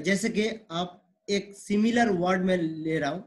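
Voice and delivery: ordinary talk, nothing marked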